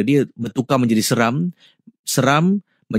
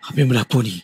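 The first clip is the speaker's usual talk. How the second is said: hurried, a touch of fear; mild frightened panting; much faster than usual. Acted. Man asking what is happening in the house, mildly frightened. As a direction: ask it quickly, as if short of breath. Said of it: "dengan nafasnya tercungap-cungap macam takutlah".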